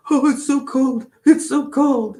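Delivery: high, loud whining about the cold; whiny complaint. Retold or imitated. Husband complaining to his wife about the cold, a loud high whine. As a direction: moan it up high like a fussy husband. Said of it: "he would be like".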